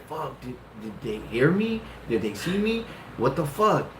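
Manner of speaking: hushed, panicked self-talk; under the breath, freaking out; panicked muttering. Retold or imitated. Talking to himself in a panic after sitting on the stairs, hushed. Panicked inner thought, whispered.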